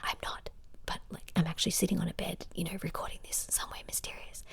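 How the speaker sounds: hushed, intimate whisper; playful, mysterious whisper. Shown as a demonstration. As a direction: whisper secretively, as if into the listener's ear. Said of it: "it almost feels like I'm whispering in your ear".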